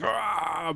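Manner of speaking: loud, high mock scream; demonstrated scream. Shown as a demonstration. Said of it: "we're gonna be screaming".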